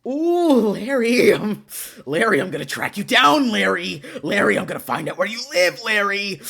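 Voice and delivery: loud, hammed-up menace; mock threatening. Streamer threatening to track Larry down, mock fury.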